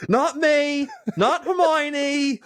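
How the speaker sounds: very high, drawn-out silly impression; mock character voice. Co-host riffing on wizard jokes, mock character voice. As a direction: stretch each word in a high comic squeal.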